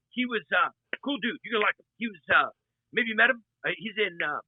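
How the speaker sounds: ordinary talk, nothing marked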